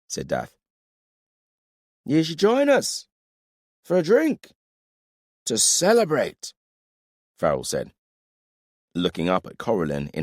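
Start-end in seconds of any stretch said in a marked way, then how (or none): 2.1-6.5 put-on drunk. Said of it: "You're drunk".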